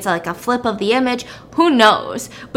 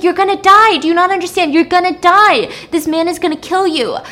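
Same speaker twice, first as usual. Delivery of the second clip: high, loud desperate warning; 7 semitones higher, 6 dB louder than usual. Retold or imitated. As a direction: shout it like she must save her. Susie warns girl desperately.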